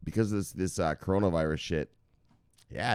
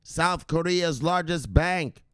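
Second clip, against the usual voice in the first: slow hype announcement; 9 semitones higher, slower than usual. Acted.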